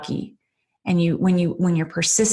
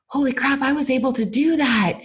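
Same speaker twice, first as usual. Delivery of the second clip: high, thrilled disbelief; mock-thrilled Holy crap; amazed exclamation; 7 semitones higher than usual. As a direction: exclaim it like a surprised win. Quoting her own thrilled past self.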